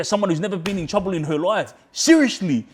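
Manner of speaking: fast, rising to a loud, high snap on Seriously; heated retold rant. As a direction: tell the cops off, building to a shout. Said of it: "going off at all the cops".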